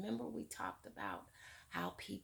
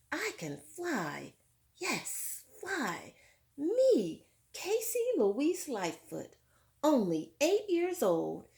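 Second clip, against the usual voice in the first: excited story reading; 8 dB louder, slower than usual. A narrator's reading. Teacher reading Casey's triumphant flight to the class, excited. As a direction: proclaim it like a girl who just learned to fly.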